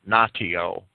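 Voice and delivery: natio said "NAH-tee-oh"; hard T. Shown as a demonstration. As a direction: sound the t in natio as a hard t, not sh. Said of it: "with a hard T".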